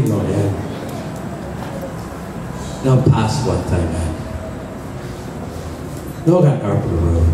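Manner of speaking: prayer. Acted. Altar call prayer, low voice.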